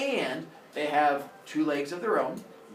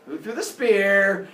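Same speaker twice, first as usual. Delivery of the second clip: loud, drawn-out, higher demand; mock outrage; 5 semitones higher, 8 dB louder, slower than usual. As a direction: roar slowly for the culprit. Struck father demands the culprit.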